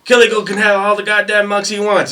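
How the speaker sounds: high, loud protective bark; drill sergeant voice. Retold or imitated. Drill sergeant confronting another sergeant, high loud bark. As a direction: stand up for your private loudly.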